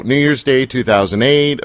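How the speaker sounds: ordinary delivery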